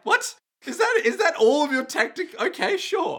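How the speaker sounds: incredulous officer. Retold or imitated. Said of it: "And it's just like".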